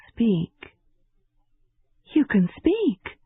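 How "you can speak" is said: surprised. A narrator's reading. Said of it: "she said in surprise one day".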